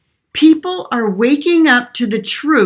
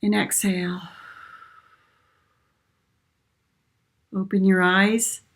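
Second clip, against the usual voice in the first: soft spa whisper; 3 dB quieter than usual. Acted. Leads a short breathing exercise.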